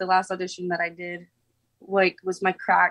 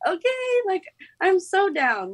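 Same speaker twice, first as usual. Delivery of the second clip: high, drawn-out eager agreement; 10 semitones higher than usual. Retold or imitated. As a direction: squeal a keen yes, pitch way up. Retelling her eager reaction.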